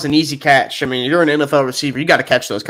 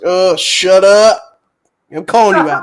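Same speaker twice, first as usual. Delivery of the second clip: fed-up snap; 6 semitones higher, 6 dB louder, slower than usual. Retold or imitated. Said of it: "I'm just like".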